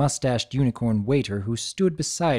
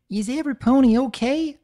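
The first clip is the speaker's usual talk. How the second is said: concerned country filly; 11 semitones higher than usual. Acted. Applebloom checking on friends.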